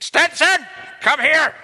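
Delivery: high, loud bellow of a name across the room; teacher hollering a pupil's name, high and loud; retold shout. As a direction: yell it like a teacher storming out the door. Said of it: "and he shouted".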